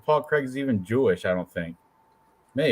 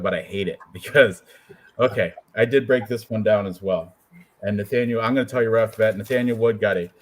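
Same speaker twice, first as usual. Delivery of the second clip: ordinary talk, nothing marked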